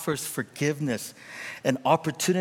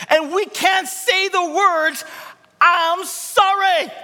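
climactic preaching; 13 semitones higher, 6 dB louder than usual. Real, not acted. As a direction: cry out the point at full force. Sermon climax.

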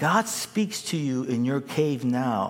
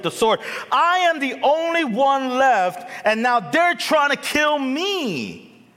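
high, loud, whining complaint; self-pitying Elijah; 8 semitones higher, 4 dB louder than usual. Retold or imitated. Elijah complains to God in hammed-up self-pity. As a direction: whine like a hurt martyr. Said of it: "says this to God".

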